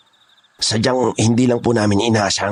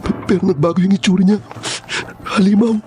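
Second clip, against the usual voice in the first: frantic panic; 10 semitones higher than usual. Acted. Kidnapper warning his boss about the monster, frantic. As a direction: beg them to believe him.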